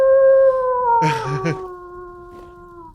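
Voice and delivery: high giddy burst of laughter. Real, not acted. Laughs after squealing.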